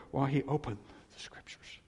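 unvoiced hush; preacher hushing the close of the verse; whispered verse ending. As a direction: let the verse end in a whisper.

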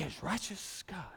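hushed, breathy reveal after the big build; whisper. Acted. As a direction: drop to a whisper for the punchline. Dropping to a hush after emphasis.

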